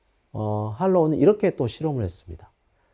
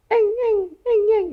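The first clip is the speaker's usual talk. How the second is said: put-on high whiny baby crying sounds; father imitating daughter's night crying; imitated baby whimper; 5 dB louder, slower than usual. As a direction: whimper like a toddler. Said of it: "이런 소리가 나요".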